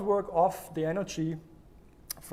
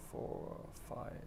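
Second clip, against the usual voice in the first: whispered count; 16 dB quieter than usual. Shown as a demonstration. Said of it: "counting breaths in a whisper".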